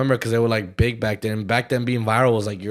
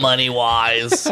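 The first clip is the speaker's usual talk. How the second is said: very slow, put-on beggar; homeless man voice; much slower than usual. Beggar clarifying what he wants, homeless man voice. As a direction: stretch it out like a comic panhandler. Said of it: "I like your homeless voice".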